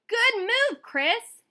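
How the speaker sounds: high, loud proud shout; cheering yell. A narrator's reading. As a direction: cheer from the stands. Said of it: "yelled Dad".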